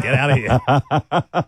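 slow, laughing take on the cop's words; laughing cop quote. Retold or imitated. Retelling the cop letting her go, laughing. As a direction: let him off through a laugh.

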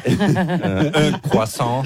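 un croissant with a light French accent, nasal on, croissant said kwa-son; a hint of a French accent ordering un croissant. Shown as a demonstration. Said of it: "do it how you did it this morning".

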